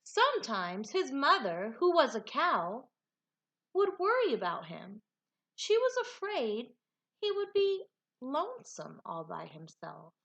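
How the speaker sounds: lively, expressive storybook reading; animated read-aloud with big swoops in pitch; reading to children. A narrator's reading. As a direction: read it like a picture book for a class. Teacher reads picture book aloud.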